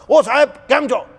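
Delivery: high, hearty street greeting; the peon greets his old boss at the crossing, loud and hearty. Retold or imitated. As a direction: hail your old boss across the crossing. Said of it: "એ વાત જ એવી રીતે કરે".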